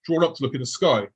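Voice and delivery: usual speaking voice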